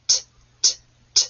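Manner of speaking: "T, t, t" as short breathy t bursts; sounding out T. Shown as a demonstration. Teacher to kids: short t sounds. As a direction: tap out the t sound. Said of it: "what's that first sound".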